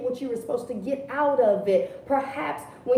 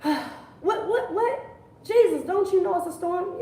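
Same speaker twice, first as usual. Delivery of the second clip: higher, disbelieving mock alarm; higher hammed-up disbelief; incredulous disciples; 6 semitones higher than usual. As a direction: act baffled that he's calm. Disciples voiced, panicking.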